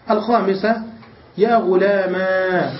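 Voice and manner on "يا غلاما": "غلاما" slow, with long final aa; ya turned alif. Shown as a demonstration. Teacher showing the fifth form, drawn-out aa ending. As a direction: stretch the open aa ending of "غلاما". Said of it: "بقلب الياء ألفا".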